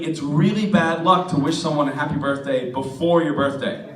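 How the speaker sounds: ordinary delivery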